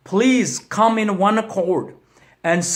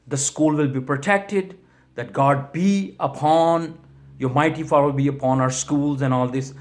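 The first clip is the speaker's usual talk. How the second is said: heartfelt prayer; 6 semitones lower than usual. Real, not acted. Pastor praying for school shooting victims' families, hushed and low.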